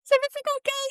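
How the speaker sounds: very high, anxious asking; high anxious question. Retold or imitated. New mother asking doula friend, high anxious. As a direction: ask it high and a little worried. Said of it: "I'm like".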